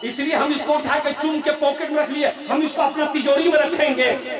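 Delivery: fervent pleading. Acted. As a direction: declaim it loudly as a flattering subject. Fisherman pleading before the king.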